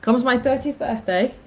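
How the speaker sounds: normal talking voice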